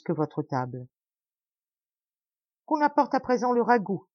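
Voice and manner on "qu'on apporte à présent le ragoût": higher, raised call for the stew; exclaiming. A narrator's reading. The host calls for the stew, exclaiming. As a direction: announce the next course with gusto. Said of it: "s'écria le Barmecide".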